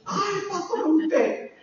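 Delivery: comic high-pitched retelling; mock surprise. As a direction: play a flustered churchgoer recognizing the pastor. Acted reply to the pastor.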